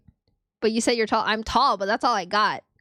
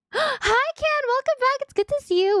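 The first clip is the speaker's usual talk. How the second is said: high squeaky hello; bright excited welcome, pitched way up; excited high greeting. Real, not acted.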